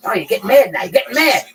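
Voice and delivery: loud mocking taunt; mock angry taunt. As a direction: needle him loudly. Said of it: "I fought back one time".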